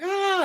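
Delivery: sustained high "aah"; mock battle cry. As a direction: imitate attackers rushing in. Voices ninjas charging in.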